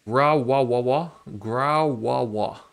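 loud, drawn-out "Grawawa"; mock word attempt. Shown as a demonstration. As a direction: stretch out each babbled syllable loudly. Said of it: "how do you say that word".

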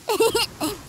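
a bright delighted giggle; giggle, thrilled to get your way; gleeful kid laugh. Acted. Little boy to brother after winning, a gleeful giggle. Kid gets his wish.

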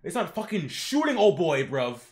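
usual speaking voice

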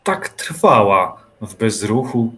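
ordinary delivery

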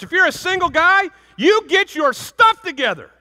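high, loud pulpit exhortation; rally the men like a revival preacher; fiery preacher charging the young men of the church; preaching at full force. Acted.

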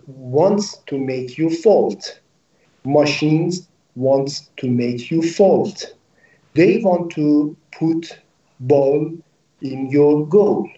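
ordinary talk

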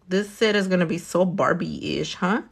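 usual speaking voice

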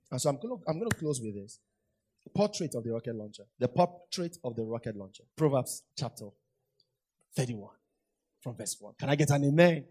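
ordinary talk

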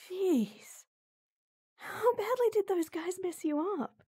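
high, alarmed exclamation at the injuries; shocked, worried outburst. Acted. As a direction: gasp at how bad it is. Shocked at the bruises.

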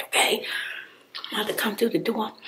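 usual speaking voice

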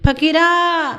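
a high, loud drawn-out call of the name; long call. A narrator's reading. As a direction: call his name out across the distance. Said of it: "ती दीर्घ हाक दूर दूर गेली". (लांब, उंच आवाजात हाक)

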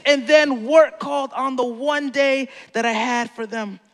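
normal talking voice

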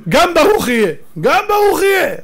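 loud, high-pitched exclamation; startled outcry. Acted. Isaac blurting out the blessing to Esau, startled and loud. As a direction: cry it out in shock, voice leaping up.

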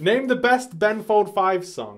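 loud, high shouted question; quiz show host. Acted. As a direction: call it out to the contestant. Plays rapid-fire quiz host.

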